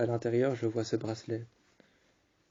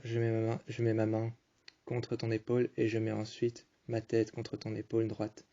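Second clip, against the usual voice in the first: ordinary delivery, nothing marked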